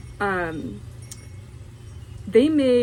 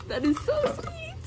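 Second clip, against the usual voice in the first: high touched coo; 5 dB quieter than usual. Real, not acted. Finding the sewing kit.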